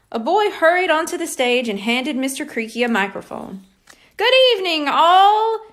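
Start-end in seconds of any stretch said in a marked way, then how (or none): 4.1-5.6 high, loud bellow into the mic. Acted. Principal greeting the auditorium, loud bellow. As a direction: boom it out to the whole hall. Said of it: "Mister Creaky bellowed into the mic".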